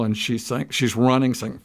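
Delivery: ordinary talk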